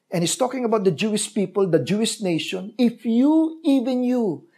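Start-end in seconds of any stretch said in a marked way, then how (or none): 2.8-4.5 raised, aching voice for Jesus weeping over the city; lamenting plea. Acted. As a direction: plead like a grieving prophet.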